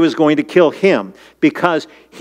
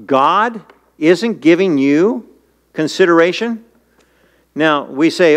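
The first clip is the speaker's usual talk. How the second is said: ordinary delivery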